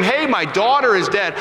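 imitating a distraught father's urgent plea; desperate father. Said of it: "a little hysterical or desperate".

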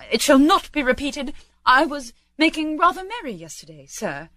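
high, apologetic clerk; pleading. Acted. Bob excuses himself to Scrooge, pleading. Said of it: "pleaded Bob".

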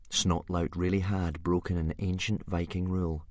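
ordinary delivery

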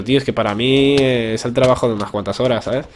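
ordinary talk, nothing marked

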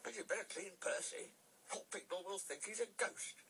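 high-pitched signalman voice; high, fussing railway worker. A narrator's reading. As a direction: play the advising signalman, pitched well up.

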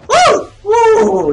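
high, loud whooping; excited whoop. Real, not acted. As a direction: let out two piercing whoops. Whooping during play.

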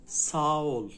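ساول with a softened g; soft g. Shown as a demonstration. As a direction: glide through the g in ساول.

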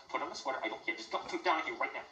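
ordinary talk, nothing marked

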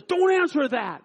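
high, loud retold command; mock-menacing warning; sharp gunman order. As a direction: snap it as the robber, acted. Retold gunman forbids answering.

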